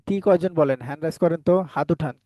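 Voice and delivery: ordinary talk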